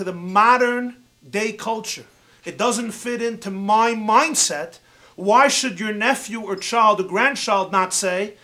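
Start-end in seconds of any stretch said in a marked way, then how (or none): none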